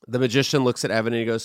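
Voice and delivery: usual speaking voice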